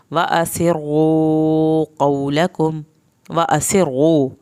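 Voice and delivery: واسروا with a hissing س and heavy ر; whistled seen. Shown as a demonstration. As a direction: recite واسروا slowly. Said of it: "سین بے سیٹی کی آواز نکالیں".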